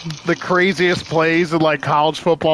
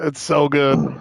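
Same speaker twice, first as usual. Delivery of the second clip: ordinary talk, nothing marked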